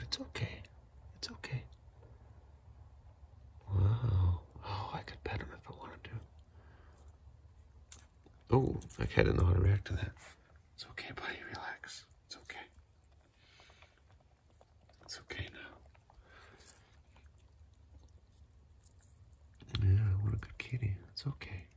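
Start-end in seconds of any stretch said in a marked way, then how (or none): 0.0-6.1 real soft whisper so as not to scare him; hushed reassurance. Real, not acted. Owner whispers near a wary stray, hushed. 10.7-21.6 real hushed, soothing whisper; calm the startled cat under your breath. Real, not acted. Owner soothes the spooked cat, whispering.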